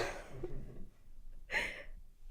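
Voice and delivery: very high voiceless laugh, almost a squeal; teary laugh. Real, not acted. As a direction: laugh through emotion. Laughs through tears about her dad.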